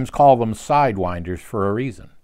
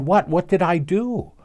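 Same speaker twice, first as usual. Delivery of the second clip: shocked; 7 semitones higher than usual. Retold or imitated. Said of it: "she was quite shocked".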